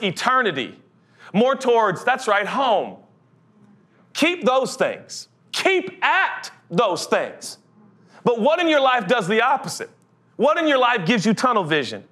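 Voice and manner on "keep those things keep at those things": very slow, each word pressed with a falling tone; preacher slowly urging the congregation to hold on; slow, emphatic urging. Real, not acted. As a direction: draw out "keep" and lean on it.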